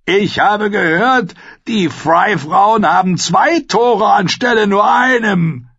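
high, loud, slow mocking shout; shouted retort. A narrator's reading. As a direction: shout back a crude comeback. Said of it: "Darauf antwortete Mark Piper".

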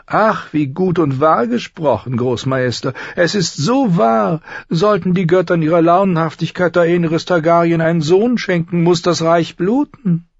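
smooth and falsely sympathetic, like a sly courtier; oily eunuch. Acted. Varys agrees, oily. (salbungsvoller Höfling, deutlich höher)

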